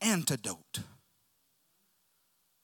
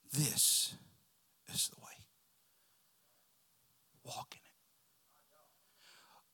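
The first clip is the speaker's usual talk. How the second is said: near-whisper with long pauses; a soft prompting from behind; little voice; 3 dB quieter, much slower than usual. Acted. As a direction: fade to a barely audible whisper. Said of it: "hear a little voice behind you".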